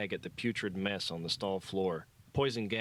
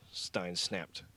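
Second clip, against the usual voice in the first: ordinary delivery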